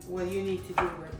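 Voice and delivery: usual speaking voice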